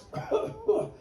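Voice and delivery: squeaky little cough; the Lorax coughing before he speaks; high cough. Acted. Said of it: "He coughed".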